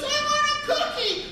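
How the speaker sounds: very high mock child's whine; demanding little kid. Comic replays his son's demand to the audience, high kid whine. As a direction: mimic a kid's insistent high demand. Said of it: "Somebody looks at you and goes".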